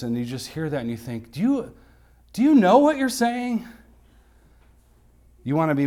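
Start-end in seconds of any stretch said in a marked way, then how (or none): 1.3-3.7 mock-disbelieving question, pitched much higher; high, incredulous scoff at worldly experts; incredulous retort.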